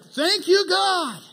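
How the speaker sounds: high, loud gloating thanks; hammed-up smug thanks; smug prayer. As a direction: lord it over him in prayer. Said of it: "he's like".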